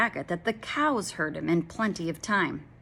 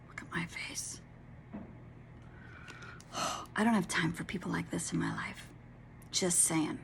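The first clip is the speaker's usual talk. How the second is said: whispered aside; 11 dB quieter than usual. Real, not acted. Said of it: "aside to listeners".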